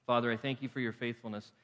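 subdued, measured praying voice with pauses; heartfelt prayer. Real, not acted. Pastor thanking God for grace, quiet congregational prayer. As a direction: pray sincerely, settling down from preaching.

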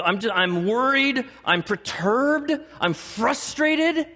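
high, stretched-out cry of worry; mock frustration. Preacher acting out people stressed by life, escalating mock frustration.

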